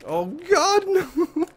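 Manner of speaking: high laughing scream; panicked scream. Real, not acted. Wolf closing in, laughing cry.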